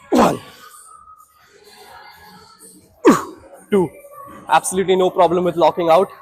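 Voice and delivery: counting reps under strain; effortful gym grunting; straining through reps. Real, not acted. Counting reps under heavy leg press.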